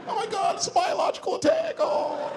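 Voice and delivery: very high panicked wail; freaking out. Acted. Passengers fearing an attack, squealed mock panic. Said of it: "Now everybody freaks out".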